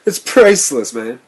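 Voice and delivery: high, loud laughing jeer; hysterical laughing. A narrator's reading. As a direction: still laughing wildly. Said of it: "burst into further hysterics".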